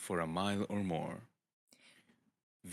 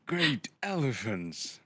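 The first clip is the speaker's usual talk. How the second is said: slow, higher booming wizard cry; Gandalf's exclamation; 4 semitones higher, 4 dB louder, slower than usual. Acted. Gandalf scolding a flustered Bilbo, slow booming exclamation. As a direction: draw out an old wizard's astonished oath.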